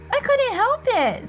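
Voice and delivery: put-on high, slow whiny kid's voice; kind of whining. Little brother excusing himself to mom, whining. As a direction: whine it like a guilty little boy. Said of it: "kind of whining".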